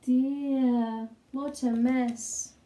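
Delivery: usual speaking voice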